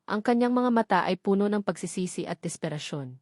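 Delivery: ordinary delivery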